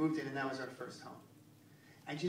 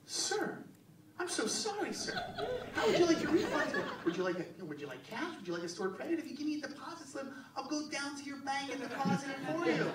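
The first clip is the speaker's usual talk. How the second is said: much higher, gushing, laughing service voice; over-polite clerk; 10 semitones higher than usual. Retold or imitated. Clerk bending over backwards for a returning customer, high gushing mock politeness.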